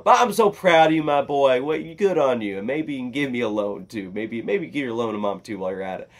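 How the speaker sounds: loud mock-proud dad, high then settling; dad's reaction. Dad praising son while asking for a loan, mock pride. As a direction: start high and loud, imitating dad's gushing. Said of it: "my dad's reaction to it was".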